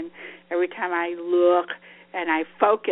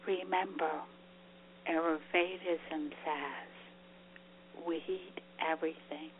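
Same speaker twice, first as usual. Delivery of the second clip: guided meditation; 5 dB quieter than usual. Acted. Leads a closing breathing meditation.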